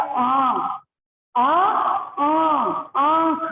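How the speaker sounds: आ stretched out, then आंख with a nasal vowel; teacher sounds out आ then आंख; nasal vowel demo. Shown as a demonstration. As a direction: build from a long आ to a nasal आंख. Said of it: "क्या बन गया?".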